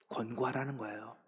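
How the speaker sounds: ordinary delivery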